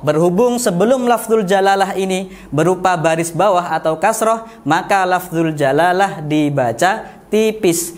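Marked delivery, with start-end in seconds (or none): none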